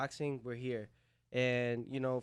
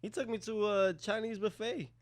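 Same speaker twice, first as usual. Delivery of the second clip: ordinary talk, nothing marked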